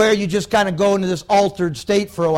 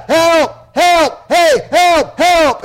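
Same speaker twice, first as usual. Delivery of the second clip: retold frantic cries for help, higher and louder; desperate screaming; 6 semitones higher, 5 dB louder, much slower than usual. Preacher voicing trapped pilot's screams for help, loud and desperate. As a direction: shout for help like a man trapped in fire. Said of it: "the pilot was screaming".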